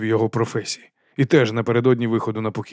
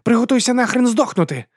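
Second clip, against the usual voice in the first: high-pitched angry bark; barked threat; 12 semitones higher, 3 dB louder than usual. A narrator's reading. Woman threatens the duke she came to kill, barking. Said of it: "гаркнула вона".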